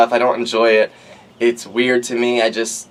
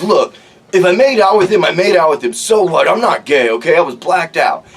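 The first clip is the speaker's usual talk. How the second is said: raised, louder indignant protest; defensive protest; 5 semitones higher, 3 dB louder than usual. Retold or imitated. Friend defending himself, raised indignant voice. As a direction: insist loudly it meant nothing. Jim defensively denies the hookup.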